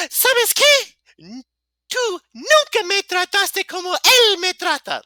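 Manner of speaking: put-on very high falsetto girl, loud and indignant. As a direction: squeal it out as an exaggerated girl. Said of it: "Girl voice on".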